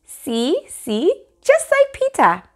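put-on very high little boy voice; childish bragging. Fudge brags to the dentist, high childish voice. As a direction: brag like a proud toddler.

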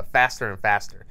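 normal talking voice

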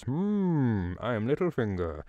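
mock sinister hum into a smug self-introduction; creepy Littlefinger. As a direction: play the oily creep introducing himself. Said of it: "turns up and goes".

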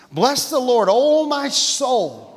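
high, emphatic pulpit delivery; impassioned preacher, pitch lifted; fervent preaching. Real, not acted. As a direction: proclaim the psalm with rising fervor. Preaches the psalm with force.

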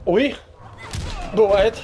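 ordinary delivery

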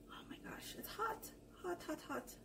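breathless whisper after a hot bite; whispered hot mouth. Real, not acted. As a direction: gasp it out, mouth burning. Food too hot in mouth.

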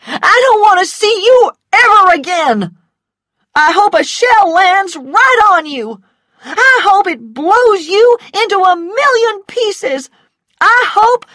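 high, loud screaming tirade; furious cry. A narrator's reading. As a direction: scream curses at him, cut off at the end. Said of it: "cried Scarlet".

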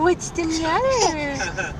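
high, slow cooing. Real, not acted. Cooing to a baby or pet.

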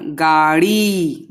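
गाड़ी with a long, slow drawn-out ई at the end; long drawn vowel. Shown as a demonstration. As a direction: stretch the final ई of गाड़ी. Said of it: "लंबा है आवाज".

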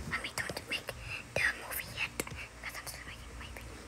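hushed, urgent whisper; real whisper. Real, not acted. Child asks mommy to wait during video, whispering. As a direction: whisper it aside, very softly. Aside to mother during recording.

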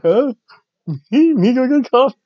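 put-on childlike bewildered voice; mock confused squeak. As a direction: play Nikki as utterly lost and surprised. Acting out confused Nikki.

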